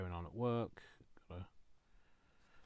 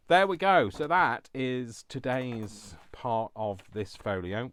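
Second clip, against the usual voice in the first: cheerful; 5 semitones higher, 12 dB louder, slower than usual. Real, not acted. Finishing the piece.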